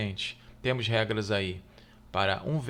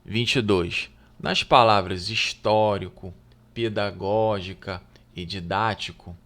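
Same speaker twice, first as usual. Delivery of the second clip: louder, deliberate read of the test question; reading the exam item; 5 dB louder than usual. Acted. Teacher reading the exam question aloud to students, deliberately. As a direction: read the question out clearly to the class.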